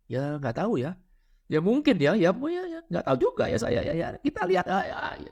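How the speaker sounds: a dukun with no confidence dithering; unsure dukun. Retold or imitated. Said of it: "Anda nggak percaya diri".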